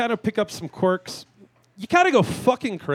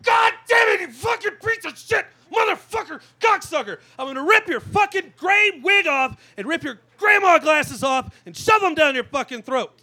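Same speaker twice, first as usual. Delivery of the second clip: high, piercing shriek of fury; screaming rage; 12 semitones higher than usual. Retold or imitated. Inner retail worker screaming at grandma customers, full-throat high shriek. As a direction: scream it like you've finally snapped. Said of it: "this is the screaming that happens".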